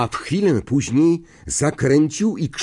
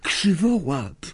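amazed whisper; 6 semitones higher, slower than usual. A narrator's reading. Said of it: "wyszeptała zdumiona Hermiona".

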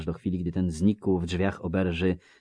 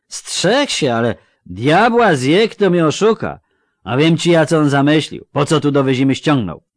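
loud, higher, slurring tipsy villager; drunk Melfi; 8 semitones higher, 12 dB louder than usual. Acted. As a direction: call out like a cheery drunk. (pijany Melfi, głośno i bełkotliwie)

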